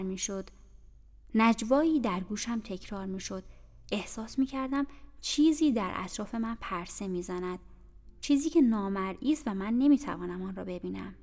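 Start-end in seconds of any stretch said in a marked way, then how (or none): none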